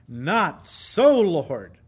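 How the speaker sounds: "Not so, Lord" with "so" punched loud, then dropping on "Lord"; emphatic refusal, each word set apart. Retold or imitated. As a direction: hit "Not so, Lord" hard and deliberately. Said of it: "In the Greek, it's emphatic. That's why I'm kind of stressing that".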